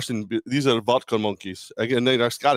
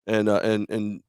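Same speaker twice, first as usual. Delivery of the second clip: normal talking voice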